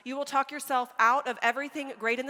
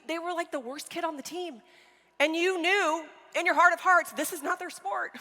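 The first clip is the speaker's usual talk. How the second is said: usual speaking voice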